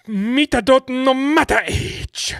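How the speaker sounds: high, slow hammed-up Japanese accent; mock Japanese accent. Showing co-hosts the regained accent, mock Japanese. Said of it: "I got back to it in the end".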